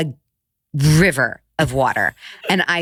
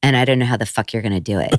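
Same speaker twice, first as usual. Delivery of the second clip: low, deadpan retold remark; matter-of-fact; 6 semitones lower, faster than usual. Young son sizing up mom's mess, matter-of-fact. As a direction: imitate a little kid stating it plainly, low and unfussed. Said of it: "matter-of-factly".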